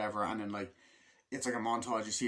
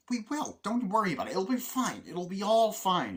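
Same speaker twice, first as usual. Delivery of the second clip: much higher, mock-reassuring voice; breezy reassurance; 9 semitones higher, 5 dB louder than usual. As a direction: wave the worry away in a raised, singsong pitch. Acts out brushing off worries.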